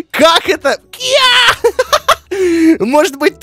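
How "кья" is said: very high martial arts yelp; kung fu cry. Pretend. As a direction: snap it out like a karate kick. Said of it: "тоже тактика кунг-фу".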